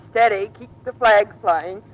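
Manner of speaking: loud, slow, commanding; giving an order. Retold or imitated. Wife mimicking husband's order, loud and slow. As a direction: bark it out like an order. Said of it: "as though you were giving an order and said".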